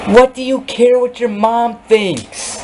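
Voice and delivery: exasperated scolding; angry, high-pitched rant. Real, not acted. Scolding absent friend about mom.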